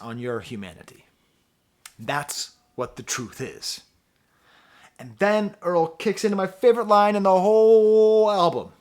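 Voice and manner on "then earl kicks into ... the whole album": much higher and louder, bursting with excitement; thrilled fan losing it over a lyric; freaking out. Real, not acted. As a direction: gush about the favorite line. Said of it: "didn't expect me to freak out over that line".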